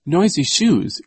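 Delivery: high, loud delighted exclaim; excited cry. A narrator's reading. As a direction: shout it out with glee. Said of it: "Duncan cried".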